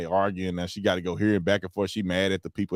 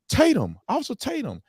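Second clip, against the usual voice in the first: fans calling out; 13 semitones higher than usual. Retold or imitated. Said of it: "people come up to me".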